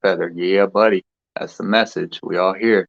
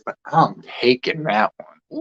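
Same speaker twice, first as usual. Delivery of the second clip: normal talking voice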